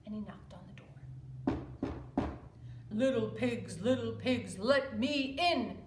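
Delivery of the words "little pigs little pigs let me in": louder, slow menacing wolf; big bad wolf. Wolf at the straw house door, slow and menacing. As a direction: lean on each word like a predator.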